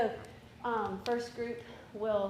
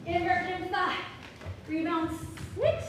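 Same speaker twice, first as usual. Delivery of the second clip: loud cue call; 6 semitones higher, 6 dB louder than usual. Real, not acted. Teacher calling dance cues.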